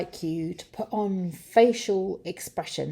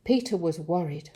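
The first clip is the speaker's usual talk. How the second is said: plain reading voice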